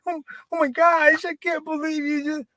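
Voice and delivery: crying on a dime to win the argument; fake crying. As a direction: turn on fake tears instantly. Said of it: "I can cry on a dime".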